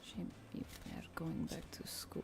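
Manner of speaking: crackly Eastern European witch, breathy and hushed. Acted. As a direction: croak it like an old fortune-teller witch. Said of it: "crackly kind of witch voice we're gonna do".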